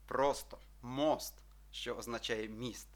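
ordinary delivery

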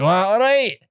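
high, aggressive Glaswegian greeting; Glasgow accent. Shown as a demonstration. Host contrasting Glasgow with Edinburgh, aggressive Glaswegian accent. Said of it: "And then Glasgow was like".

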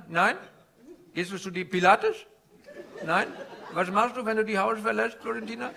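put-on comic Indian-accented stand-up guru.

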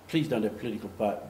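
normal talking voice

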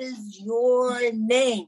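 imitating someone talking to a foreigner; slow, drawn-out question. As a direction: ask it word by word, hugely stretched. Stranger addresses presumed non-English speaker.

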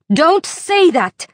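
high, loud, slow scream. A narrator's reading. Isley to Ensel, finally breaking, full scream. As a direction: scream it with everything you have. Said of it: "she screamed at the top of her voice".

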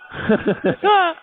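schrilles Auflachen; hohes Lachen. Real, not acted. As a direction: lacht sehr hoch los. Laughs at the music. (hoch auflachen)

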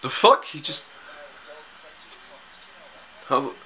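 jolted by what just happened; shocked. Real, not acted. Shocked reaction to on-screen twist.

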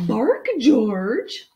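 loud, lilting mother's command; coaxing mother. Acted. Mother dog telling her pup to bark, loud coaxing. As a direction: urge the pup on, full voice.

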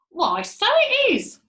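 high, loud, drawn-out realisation; astonished discovery. Acted. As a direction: sound amazed, stretching it out high. Voiced Mrs Twit's startled discovery.

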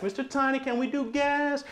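high, eager mock question from a fan; imitating curious viewers. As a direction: play a hopeful viewer asking Mister Tiny. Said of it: "a lot of you ask".

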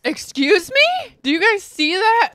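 outraged disbelief at the screen; shocked. Real, not acted.

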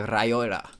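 "rajora" with a rolled R at the start; trilled R demonstrated on "rajora". Shown as a demonstration. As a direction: roll your tongue on the R of "rajora". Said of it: "let me hear you roll your tongue like".

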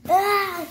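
short, high pretend scream; cry of pain. Victim with a broken leg, short high scream of pain. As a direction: yelp in mock agony.

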